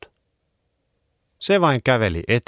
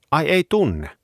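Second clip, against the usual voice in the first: surprised; 9 semitones higher than usual. Acted. Surprised dog question.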